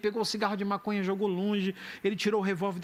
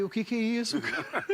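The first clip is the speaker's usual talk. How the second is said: laughing question; 3 semitones higher than usual. Retold or imitated. Retold own puzzled reply.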